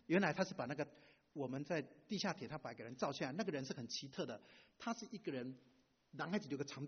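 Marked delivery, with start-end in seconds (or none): none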